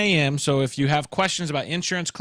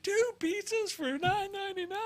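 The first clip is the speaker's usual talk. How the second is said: high squeaky ad voice, a bit softer; squeaky pitch line; 6 dB quieter than usual. Retold or imitated. Man in the pizza ad asking the price in a high squeak. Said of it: "he's like".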